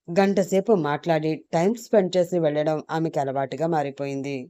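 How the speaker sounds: usual speaking voice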